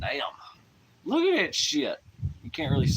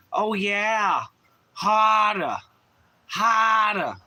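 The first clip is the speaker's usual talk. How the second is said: higher-pitched put-on Midwest accent; exaggerated Midwest accent, key phrase drawn out; 4 semitones higher than usual. Streamer showing chat he can almost do a Midwest voice, Midwest accent. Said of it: "Midwest thing".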